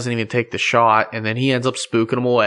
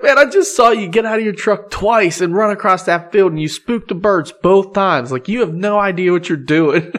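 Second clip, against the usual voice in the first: high, heated mock yell through laughs; retold hot-headed rant, laughing; Bud yelling; 7 semitones higher than usual. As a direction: tell him off, laughing as you go. Bud screaming at the guy.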